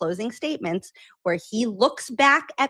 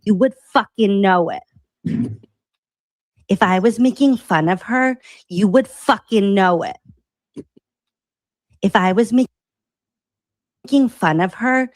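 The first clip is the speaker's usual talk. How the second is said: defiant emphatic; 7 dB louder than usual. Real, not acted. Clip speaker emphatic defiance.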